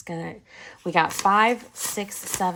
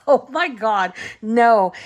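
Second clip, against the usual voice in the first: louder, shocked no; mock shocked; 3 semitones higher, 7 dB louder, slower than usual. Retelling her shocked reply to her husband. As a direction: play up the disbelief.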